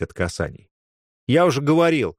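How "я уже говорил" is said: sullen. A narrator's reading. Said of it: "хмуро отозвался Тимур".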